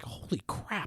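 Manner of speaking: high-pitched, hushed mock awe; imitated amazement. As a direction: imitate a blown-away audience, high and soft. Retold amazed reaction of audience.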